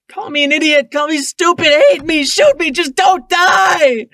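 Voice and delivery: high, loud desperate screaming; screamed begging. A narrator's reading. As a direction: scream at him to live. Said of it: "I begged as my raspy voice".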